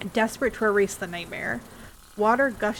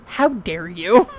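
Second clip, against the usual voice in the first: slow, much louder hammed-up indignation; theatrical, much louder how dare you; mock outrage; 10 dB louder, slower than usual. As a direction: pretend to be deeply offended. Mock offense at homunculus joke.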